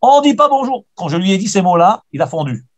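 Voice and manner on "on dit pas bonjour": "On dit pas bonjour" lancé plus haut et plus fort, imitant la colère; imitating a shout. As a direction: rejoue le cri de l'homme sans vraiment hurler. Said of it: "tu cries devant tout le monde". (il imite l'homme qui crie devant tout le monde, cri à moitié joué)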